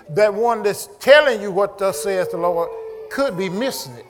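loud, emphatic sermon delivery; forceful preacher's exhortation; preaching. Real, not acted. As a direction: drive the warning home to the congregation.

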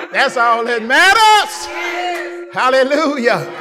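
high, shouted, ecstatic preaching; preaching at full force. Acted. Preacher rejoicing to his congregation, shouted and ecstatic. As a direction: lift the church with every line, slowing on the peak.

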